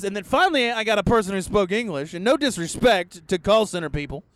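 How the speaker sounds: usual speaking voice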